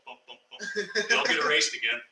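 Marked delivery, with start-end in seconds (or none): 0.1-1.1 very soft, repeated little blips; imitated backspace bumps. Storyteller imitates the number being erased, very soft bumps. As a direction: drop to tiny soft bumps, one per deleted character. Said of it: "all of a sudden, it's like".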